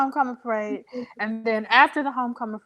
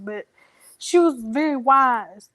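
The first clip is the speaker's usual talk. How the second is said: ordinary talk, nothing marked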